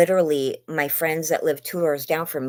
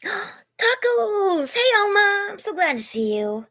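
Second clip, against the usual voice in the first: gasping excited greeting; slower than usual. Real, not acted. Streamer delighted to see a viewer join. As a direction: gasp with joy at a friend arriving.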